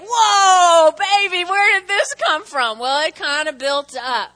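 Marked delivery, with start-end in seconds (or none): 0.0-2.8 loud, high, exaggerated astonishment; stunned husband. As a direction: recoil from an outburst in mock shock. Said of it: "he's looking at me like".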